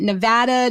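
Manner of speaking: Nevada with short a as in "cat"; alternate pronunciation. Shown as a demonstration. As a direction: say Nevada as nuh-VAD-uh. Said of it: "I've heard Nevada pronounced so many ways".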